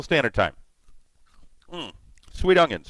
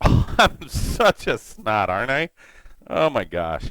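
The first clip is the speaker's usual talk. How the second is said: slow, laughing self-tease; 7 semitones lower than usual. Real, not acted. Streamer teasing his viewers about hidden food, laughing and slow. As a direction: chuckle through the words.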